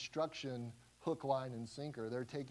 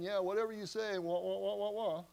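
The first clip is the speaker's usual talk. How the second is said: higher, sing-song brush-off; dismissive mockery; 5 semitones higher than usual. Leaders brushing off Isaiah, mocking wah-wah. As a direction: wave him off like a droning teacher. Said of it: "They're saying".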